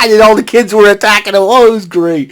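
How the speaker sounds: normal talking voice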